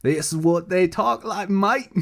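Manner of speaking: put-on a Texan faking an Australian accent, mate. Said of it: "if a Texas person went over to Australia".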